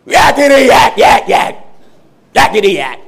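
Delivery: high, loud demon yakking; shrill demon voice. Acted. Preacher mimics the demon interrupting Jesus, shrill and loud. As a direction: shriek it out in a shrill, piercing voice. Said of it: "Was making the shrill voice".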